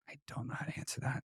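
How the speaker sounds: breathy whisper, under the breath; whispered aside. Retold or imitated. To himself when people ask how, whispering. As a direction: let it slip out as a stunned whisper. Inner reaction to being asked.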